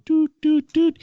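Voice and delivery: high, loud electronic beeps; imitated alert tone. Former police operator mimicking the code three tone, imitated beeps. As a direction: mimic three even dispatch tones. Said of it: "there would be this tone".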